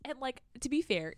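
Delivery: normal talking voice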